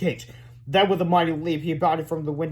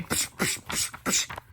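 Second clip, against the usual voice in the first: beatbox; 5 dB quieter, slower than usual. Acted. Beatbox between song lines.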